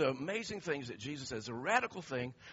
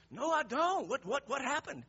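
high-pitched, stammering bewilderment; baffled protest; 11 semitones higher, 4 dB louder than usual. Retold or imitated. Answering an angry coworker's accusation, baffled and high-pitched. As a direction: plead innocence, pitched way up.

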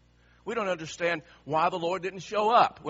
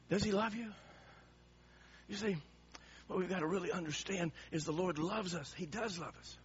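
soft, lower, intimate sermon voice; hushed preaching; 3 semitones lower, 8 dB quieter than usual. Acted. Preacher quietly asking the congregation, hushed and searching.

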